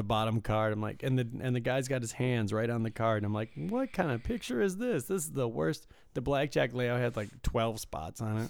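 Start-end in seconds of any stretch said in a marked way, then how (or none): 3.5-5.9 high-pitched retold disbelief; baffled complaint, voice jumping up high; mock incredulity. Griping about a bad blackjack photo, incredulous.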